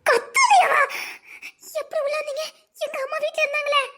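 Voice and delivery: high-pitched, flustered challenge; alarmed protest. Acted. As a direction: demand answers in a panic. She confronts the intruder. (பதறிக் கேள்வி கேட்பது)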